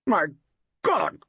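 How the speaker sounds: sudden choked exclamation. A narrator's reading. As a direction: force it out as if gagging. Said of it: "choked George Mason suddenly".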